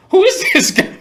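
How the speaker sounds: high, loud astonished laughing question; amazed. Retold or imitated. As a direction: marvel with a laugh, far up in pitch. Attorney amazed at Tone.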